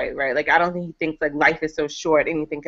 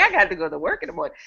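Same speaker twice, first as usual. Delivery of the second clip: mimicking husband; 4 dB quieter, much faster than usual. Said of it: "He's like".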